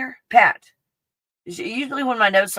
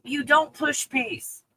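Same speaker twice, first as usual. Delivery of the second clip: ordinary talk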